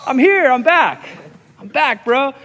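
loud, high playful call; exuberant announcement. Retold or imitated. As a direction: announce yourself to the neighbor, hammed up. Announcing return to upset neighbor.

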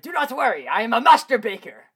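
high, loud, proud declaration; heroic cat voice. Retold or imitated. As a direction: proclaim it like a swashbuckling cat. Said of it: "he's like".

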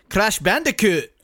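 amateur imitation of a Scottish accent; imitated Scottish accent. Quoting a Scottish actress's favourite game, imitated Scottish accent. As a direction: put on a rough Scottish accent. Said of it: "I'm not good at the Scottish accent".

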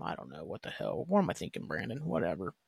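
low, soft mutter to himself; muttered self-doubt. Real, not acted. Host doubts his own theory, muttering low to himself. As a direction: trail into a low grumble, giving up on the thought.

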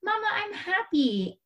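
high-pitched imitation of a small daughter; mimicking a child's happy announcement to mom; little daughter. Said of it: "my daughter Amelia said to me".

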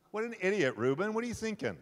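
loud, high scolding of Reuben; mock exasperation. Jacob scolding his son Reuben, loud mock exasperation. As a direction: throw up your hands at him.

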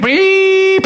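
long, high-pitched beep; imitated censor bleep. Host redacting what he shares, long high mock bleep. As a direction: hold a high bleep over the word.

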